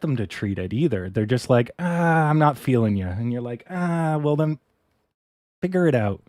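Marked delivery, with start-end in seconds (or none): 1.8-3.1 breezy brush-off, higher and louder; dismissive doctor impression. Doctor brushing off a gut patient, mock dismissive voice. 3.7-6.2 snide mock reply, higher and louder; sarcastic retort. Patient mocking doctors who will not help, sarcastic higher voice.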